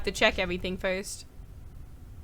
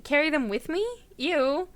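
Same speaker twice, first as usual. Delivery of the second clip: usual speaking voice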